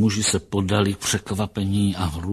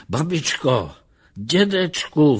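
high, eager calls to grandparents; calling out; 7 semitones higher, 4 dB louder, slower than usual. A narrator's reading. Lustig at the door calls for his grandparents, calling out. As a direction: call loudly through a closed door. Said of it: "volal Lustig".

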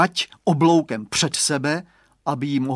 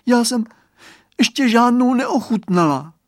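put-on high, loud childlike denial; little girl's protest; 10 semitones higher, 8 dB louder than usual. A narrator's reading. Girl answering the boy's question, high loud protest.

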